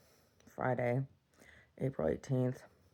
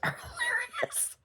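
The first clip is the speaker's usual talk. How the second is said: laughing through the words; 11 dB quieter than usual. Real, not acted. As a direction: crack up while talking. Cracking up at fart videos.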